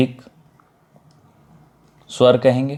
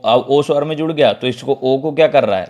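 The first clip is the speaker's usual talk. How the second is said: usual speaking voice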